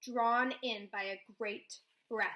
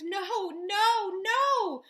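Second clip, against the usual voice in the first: much higher and louder, rising in terror; panicked cries; 9 semitones higher, 10 dB louder, much slower than usual. A narrator's reading. House walks off, girl panics.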